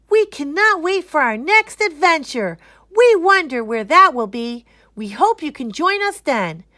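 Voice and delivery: high, loud eager sign-off; excited farewell. Acted. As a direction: invite the listeners along. Kids sign off to audience.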